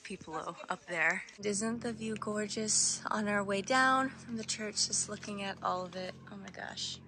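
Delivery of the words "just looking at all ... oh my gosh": usual speaking voice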